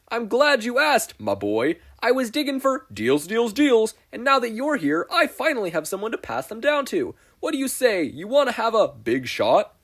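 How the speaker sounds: high, loud huckster pitch; glitchy pitchman announcing himself; Spamton salesman voice. Acted.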